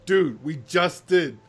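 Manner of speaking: mock exasperated reply to the Master; imitating a fed-up Doctor; deadpan Doctor. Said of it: "And he's like".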